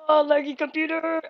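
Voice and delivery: loud, high, fast outburst; high-pitched burst of exasperation; frustrated yell. Real, not acted. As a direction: yell it at the lagging computer. Outburst at lag.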